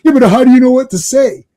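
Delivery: mock baffled challenge, loud and high; Terry. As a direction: demand an answer like a confused dude. Retold Terry baffled, challenging the actor.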